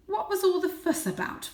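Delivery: high, demanding father cat; a hint of father cat scolding Varjak; annoyed father. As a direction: press the kitten impatiently.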